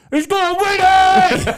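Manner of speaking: high, slow triumphant yell; shouting. Retold or imitated. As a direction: bellow it out like a winner. Announces the baby's birth.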